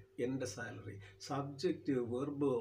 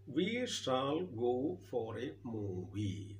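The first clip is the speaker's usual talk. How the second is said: very slow, drawn-out reading; much slower than usual. Shown as a demonstration. Teacher reads the sentence to class, very slow and drawn out. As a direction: stretch each word out for the class.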